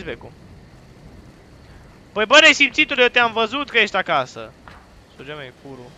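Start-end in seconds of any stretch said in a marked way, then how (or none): 2.1-4.5 loud, high accusation; indignant yelling. Real, not acted. Player telling someone they were seen at home, indignant shout. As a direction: yell at someone caught hiding. Accuses game character of hiding.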